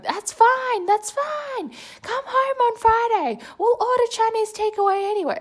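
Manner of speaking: high, loud screeching mum voice. Retold or imitated. Mum screeching from background to calm her offended daughter. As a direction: shriek it like a flustered mother backpedalling. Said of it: "mum was screeching in the background".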